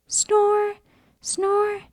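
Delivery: two light sleepy snores; snoring. Acted. Peppa snoring.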